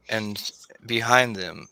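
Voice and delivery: ordinary talk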